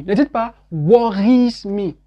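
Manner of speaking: usual speaking voice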